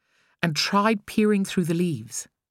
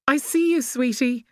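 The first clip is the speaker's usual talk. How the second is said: higher, forced cheerful mother; 9 semitones higher than usual. A narrator's reading. Mother answering her son, forced cheerful. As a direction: brighten your voice over worry. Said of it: "Georgina forced a cheerful tone".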